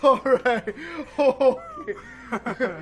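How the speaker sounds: loud laughter through words; laughing. Real, not acted. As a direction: laugh it out after a fright. Laughing after the scare.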